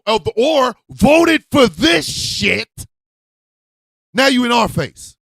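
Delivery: high, loud shouting in a rage; furious shout. Real, not acted. Ranting at voters who ignored warnings, furious shout. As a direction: yell it at them, fed up.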